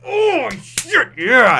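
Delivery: hyped reaction. Retold or imitated. Reviewer's own reaction to panel.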